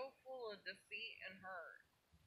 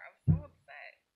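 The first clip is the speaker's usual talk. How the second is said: ordinary talk, nothing marked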